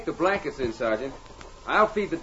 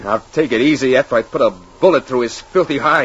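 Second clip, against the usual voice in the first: angry; 3 semitones lower, 7 dB louder than usual. Acted. Bob vows to kill the fugitive.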